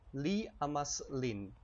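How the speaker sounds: slow, careful delivery; slow Esperanto demo. Shown as a demonstration. Teacher to viewers, slow model phrase. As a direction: model it slowly for repeating. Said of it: "how would you say he loves, um, him?".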